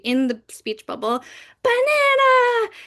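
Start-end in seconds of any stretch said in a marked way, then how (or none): 1.6-2.7 high, slow, loud burst of joy; happy exclaiming elephant. Acted. Elephant shouting with joy over a picture book, high and drawn out. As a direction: belt it out like a delighted cartoon elephant. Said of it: "have a very happy moment where the text gets really big".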